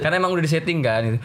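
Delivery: ordinary delivery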